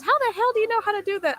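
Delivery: high incredulous mother; a stunned mom in disbelief; astonished mom. Retold or imitated. Mom staring at her kid cooking, high and disbelieving.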